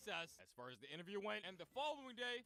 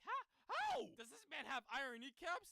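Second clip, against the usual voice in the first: shocked Higuchi; 5 semitones higher than usual. Retold or imitated. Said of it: "And Higuchi is like".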